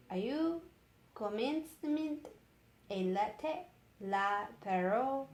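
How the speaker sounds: halting, careful attempt at French; hesitant reading of French; reading French aloud. Shown as a demonstration. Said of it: "Then he read it in French".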